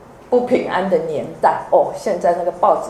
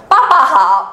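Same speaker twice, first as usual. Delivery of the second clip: put-on dutiful child greeting; 6 semitones higher, 7 dB louder than usual. Child forced to greet dad.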